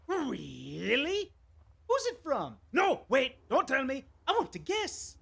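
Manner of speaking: giddy, high and loud mad god; eager, childlike mad-god excitement; squeaky excited Sheogorath imitation. Voicing Sheogorath game dialogue.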